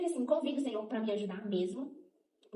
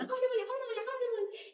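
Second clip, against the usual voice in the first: put-on much higher child-like calling voice; kids calling mom; 14 semitones higher than usual. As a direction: mimic small kids waking their mother, pitched way up. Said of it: "as crianças aqui na beirada da cama falando". (voz aguda imitando crianças chamando a mãe)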